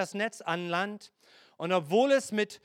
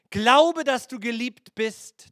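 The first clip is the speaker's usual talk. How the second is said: Glaube called out loud, then dass du geliebt bist slower; emphatic first version; 4 semitones higher, 6 dB louder, slower than usual. Shown as a demonstration. Preacher sets first version against second, Glaube loud. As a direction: punch Glaube, then slow down. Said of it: "Seht ihr den Unterschied zwischen den zwei?".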